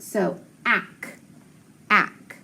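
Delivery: "ack" twice with a short A, like in cat; teacher giving the short A of action; short A sound. Shown as a demonstration.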